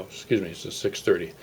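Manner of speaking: usual speaking voice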